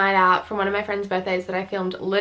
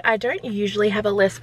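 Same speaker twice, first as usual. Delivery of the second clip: ordinary talk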